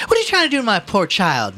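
high, loud protesting mother; indignant mother. A narrator's reading. Mother confronting the policeman over her son, indignant. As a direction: protest loudly on behalf of your child.

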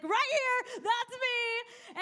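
high, loud, teary imitating a woman; excited recognition. Pastor imitating a moved congregant, high and loud. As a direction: squeal out that it's you. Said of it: "This lady's like".

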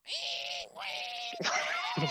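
very high squealing noise; shrill squeal imitation. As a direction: mimic the rabbit's shriek. Imitating crazy rabbit noises.

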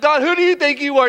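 acted affronted question; indignant challenge. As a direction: confront him in one quick run. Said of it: "have you ever had that conversation".

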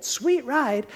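ordinary talk

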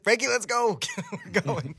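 high, loud urgent call to a friend; retelling the call to rush out. Retold or imitated. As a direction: shout it as you grab your keys. Son rushing to help mom.

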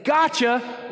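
gotcha shouted high and slow; loud gotcha. Shown as a demonstration. Pastor contrasting punishing God, gotcha shouted. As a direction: fling gotcha out as an accusation. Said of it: "We're contrasting that".

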